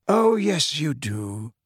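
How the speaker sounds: gently contradict, letting it sink down; very calm. A narrator's reading. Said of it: "still more calmly".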